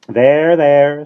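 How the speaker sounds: loud, gruff consoling bird. A narrator's reading. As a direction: comfort brusquely. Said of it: "said the starling gruffly".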